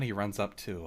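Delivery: ordinary talk, nothing marked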